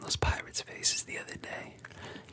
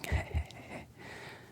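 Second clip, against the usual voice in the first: whispered bandit laughter; 3 dB quieter than usual. Acted. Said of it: "the other bandits join in laughing".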